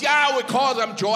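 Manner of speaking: reply in a put-on Irish accent. Irish comic explains local names to the American, Irish accent. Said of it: "And he goes".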